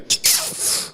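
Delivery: long breathy puff out; imitated smoke exhale. Drugged boat crew exhaling smoke on deck, mimicked puff. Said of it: "stood at the top going".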